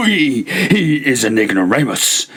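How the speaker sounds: high cackle into mocking words; drunken laugh. A narrator's reading. Fortunato mocking Lucchesi, high drunken cackle.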